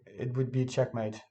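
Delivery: usual speaking voice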